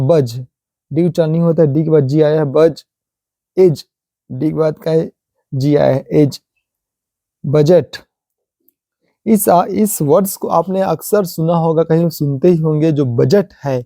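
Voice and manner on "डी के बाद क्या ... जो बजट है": ordinary talk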